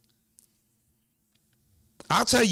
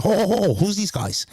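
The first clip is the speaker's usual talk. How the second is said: Boston doorman asking who these guys are, mildly hammed; Boston accent; 7 semitones higher, slower than usual. Kenny at the door quizzing the crew, light Boston accent. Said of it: "little, um, uh, Boston accent".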